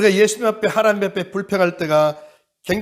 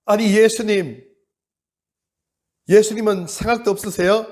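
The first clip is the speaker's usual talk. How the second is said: Martha's complaint; 5 semitones higher, 5 dB louder than usual. Acted. (예수님께 따지는 마르다, 높고 느리게)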